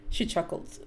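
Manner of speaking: ordinary delivery, nothing marked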